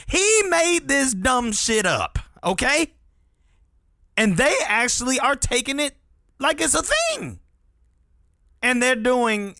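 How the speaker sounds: indignant. Real, not acted. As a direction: rant in disbelief. Outraged rant, high and loud.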